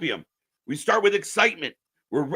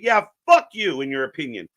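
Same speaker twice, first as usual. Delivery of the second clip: usual speaking voice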